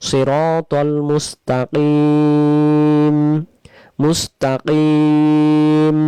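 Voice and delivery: Mustakim drawn out and held; slow recitation. Shown as a demonstration. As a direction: chant it slowly as in prayer. (ধীরে তিলাওয়াত)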